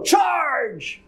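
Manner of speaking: high battle cry; charge shout. Pretend.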